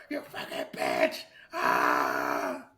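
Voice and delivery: retelling the yelling after he walks out, low and slow; background yelling. Retold or imitated. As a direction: growl it like distant yelling.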